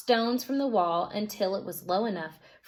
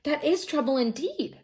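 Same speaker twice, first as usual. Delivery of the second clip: cried; 5 semitones higher than usual. Acted. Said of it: "cried Beckon".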